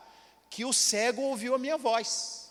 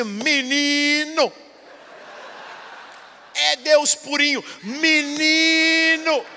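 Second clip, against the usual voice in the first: slow, drawn-out, higher cry of wonder; awed exclamation; 4 semitones higher, 4 dB louder, much slower than usual. Retold or imitated. Northeastern woman on the bus, slow awed louder exclamation. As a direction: stretch out menino in awe.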